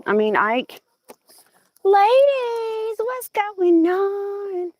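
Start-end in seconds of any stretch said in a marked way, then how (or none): 1.8-4.7 high, long drawn-out greeting; high-pitched drawn-out call; calling the hens. Real, not acted. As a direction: call out to the ladies in a high, slow holler.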